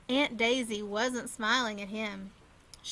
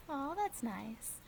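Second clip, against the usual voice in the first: soft aside; 9 dB quieter, slower than usual. Real, not acted.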